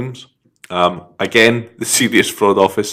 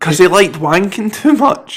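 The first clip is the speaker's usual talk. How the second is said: mock high voice; 12 semitones higher than usual. Mocking interjection, high voice.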